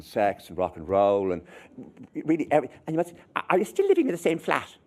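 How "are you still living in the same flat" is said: higher, pointed mother's question; lightly voiced probing mother. Mother probes the returning child, pointed. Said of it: "something about her voice".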